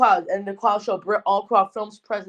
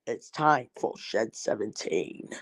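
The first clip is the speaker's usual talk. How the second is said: low, slow, hushed narrator voice; dark, softer storyteller imitation, much lower; Paul's vids impression; 11 semitones lower, 8 dB quieter, slower than usual. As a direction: drop low and draw it out like a haunting narrator. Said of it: "I'm gonna do it and push up Paul's vids".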